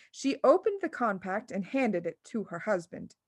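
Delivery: usual speaking voice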